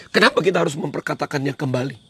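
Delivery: ordinary talk, nothing marked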